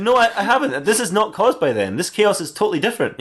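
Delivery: April. Acted. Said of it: "She said".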